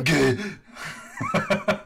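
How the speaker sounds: short laughing gah; silly battle cry. Pretend. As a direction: bark a goofy sword grunt. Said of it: "you should go, "Gah!".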